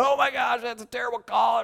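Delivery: high, loud outraged mock yell; yelling coach. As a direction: imitate a coach yelling in protest from the dugout. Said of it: "they'll start yelling as they come out".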